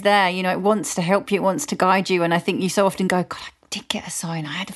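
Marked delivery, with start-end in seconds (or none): 3.2-4.7 hushed, voiceless whisper; whispered inner thought. Acted. You realising you had ignored a sign, whispered. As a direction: whisper it as if to yourself.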